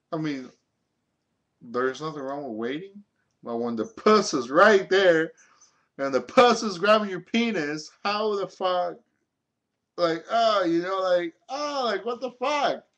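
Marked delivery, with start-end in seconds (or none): 3.4-12.9 higher and louder in disbelief; can't believe he walked away; incredulous rant. Real, not acted. Telling friends about the virgin who walked out, incredulous.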